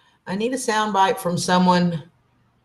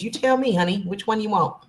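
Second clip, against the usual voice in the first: usual speaking voice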